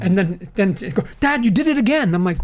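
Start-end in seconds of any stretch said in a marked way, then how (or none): 1.2-2.1 imitating his daughter. Dad voicing his daughter catching him groaning in the theatre, higher scolding. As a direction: call Dad out like an exasperated kid.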